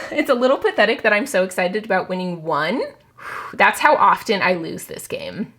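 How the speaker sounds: ordinary talk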